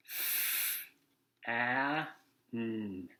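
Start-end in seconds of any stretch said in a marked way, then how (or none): 0.1-0.9 F as a soft breathy f hiss; letter sound. Shown as a demonstration. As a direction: blow out F as a long fff. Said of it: "now I'll do it with you".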